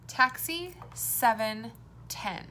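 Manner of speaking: very slow, drawn-out repeat of the words. Shown as a demonstration. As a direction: stretch each word out for the children. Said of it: "Which one is different?".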